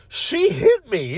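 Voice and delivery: high, slow incredulous complaint; retold outrage. Worker telling coworker about being hit, retold outrage. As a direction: draw it out in high-pitched indignation.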